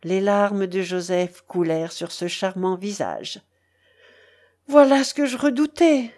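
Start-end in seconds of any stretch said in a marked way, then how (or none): 4.7-6.1 loud, high anguished cry. Acted. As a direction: burst out in alarm, high and loud. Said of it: "s'écria-t-il".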